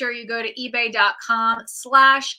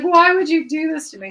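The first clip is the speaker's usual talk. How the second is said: higher, louder mock distress; imitating crying mom; 6 semitones higher, 8 dB louder than usual. Daughter retelling her mom's reaction to smelly furniture, mock-tearful. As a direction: retell mom's upset plea, pitched up. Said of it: "my mom was crying".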